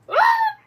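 very high, loud yell; excited scream. Pretend. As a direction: shriek out at the climax of cheering. Yell while urging bird on.

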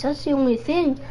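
usual speaking voice

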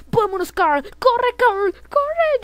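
high, loud, rising urgency; play-acted escape panic; urgent shouting. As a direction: yell at your companion to run. Urging Carl to run.

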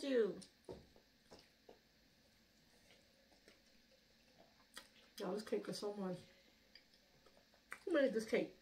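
talking between bites; chewing while talking. Real, not acted. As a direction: mouth partly full of cake. Seen 0: Woman holds bitten cake piece, mouth seemingly chewing after bite.

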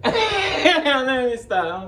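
long wheezing laugh, then slow high words; laughing too hard to go on; breathless laugh. Real, not acted. Vlogger laughing at himself, breathless.

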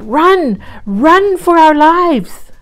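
high, loud panicked cry; frantic shout. A narrator's reading. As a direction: yell it like fleeing for your life. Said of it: "The duck called up".

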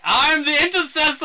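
high, loud hammed-up hero shout; imitating a grand battle cry; mock heroic proclamation. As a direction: announce yourself like an action-movie saviour. Quotes hero's reveal again.

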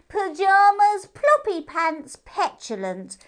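high, loud teasing hare voice, slow on ploppy pants; cheeky moon hare. Acted. Moon hare teases P J with a high cheeky voice. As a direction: tease her with each silly name. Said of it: "just as firmly".

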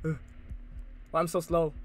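ordinary talk

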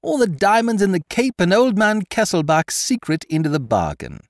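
very high, loud victory cry; shouting in triumph. Acted. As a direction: crow in triumph as the letter appears. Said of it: "he shouted in triumph".

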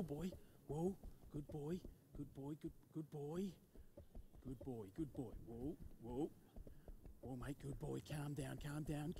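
soothing the horse. Acted. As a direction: settle the colt gently, almost breathing the words. Soothing the frightened horse alone.

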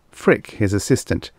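usual speaking voice

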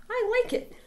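high, eager little voice; little prairie dog. Acted. Small prairie dog telling the others it loves the fuzz. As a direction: squeak it with delight.

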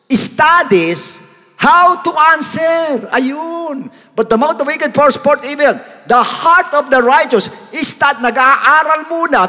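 high, slow, emphatic scripture preaching; full-force preaching. Acted. Preacher expounds Proverbs to the congregation, high emphatic preaching. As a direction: stretch the key words and pitch them high.

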